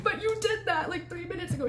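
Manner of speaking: high, squeaky whine through laughter; incredulous protest. Retold or imitated. Telling what she said to her roommate, squeaky disbelief. Repeats the upset reply.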